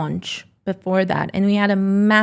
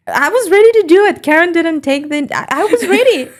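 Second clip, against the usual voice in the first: much higher and louder, bursting with glee; gleeful excitement; 9 semitones higher, 6 dB louder than usual. Real, not acted. Telling the host she was ready, giddy high excitement. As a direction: squeal it out like the best prank ever. Gleeful about paying for Karen.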